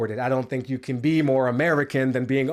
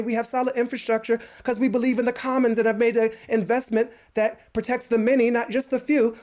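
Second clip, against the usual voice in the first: mock New Zealand accent; 8 semitones higher than usual. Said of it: "that's not even the right accent".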